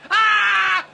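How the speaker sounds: sudden high pained scream. Acted. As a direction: scream out in agony. Screams during circumcision stunt.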